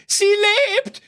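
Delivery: shrill, high cry for help; panic scream. Acted. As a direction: scream as loud as possible. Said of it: "schrie, so laut er nur konnte". (panischer Hilfeschrei)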